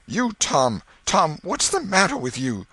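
high, loud alarm; frightened aunt demanding an answer; gasped out. A narrator's reading. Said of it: "she gasped out".

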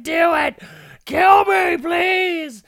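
very high, loud mock shriek; desperate begging scream. Man strapped in electric chair begs for death, mock shriek. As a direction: scream the plea, hammed right up. Condemned man demanding his execution.

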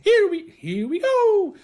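very high, drawn-out cartoon voice; slow falsetto catchphrase imitation; Mario. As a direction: squeal it like a game hero. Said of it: "is the voice of Mario".

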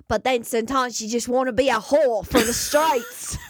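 lightly put-on British street accent; playful British street-girl accent. Joking about accents, a light British street accent. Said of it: "being a real British, Polish lady".

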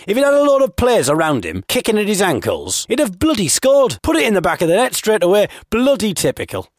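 high, worked-up mock outrage; football fan rant. Blagger covers his mistake to the fan, mock-outraged. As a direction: rant like an exasperated supporter.

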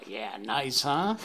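ordinary talk, nothing marked

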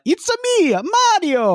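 high, exuberant cartoon Italian accent; very high mock-Italian catchphrase voice; Mario. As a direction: sing it out in a hammed-up Italian accent. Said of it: "It's really hard to do an Italian accent".

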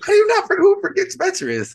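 real shocked disbelief; incredulous. Real, not acted. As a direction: pitch up in disbelief. Incredulous at wife forgetting friend.

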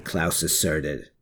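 usual speaking voice

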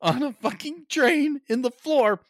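loud, rising comic outrage; exasperated rant. Real, not acted. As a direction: build to high, loud indignation. Exasperated rant about stupid plot.

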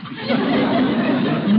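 high, flustered giggle that spills out; embarrassed high titter; nervous laugh. Acted. Host laughs at nephew's cheeky remark.

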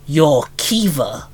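ordinary delivery

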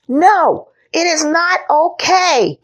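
put-on six-year-old knight losing his temper; boyish shout. A narrator's reading. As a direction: shout like an outraged little boy. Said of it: "shouted Henry".

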